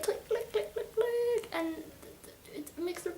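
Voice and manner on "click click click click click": quick tiny high clicks, softly; imitated clicking. Juliet calls for Warner, imitated as soft high clicking.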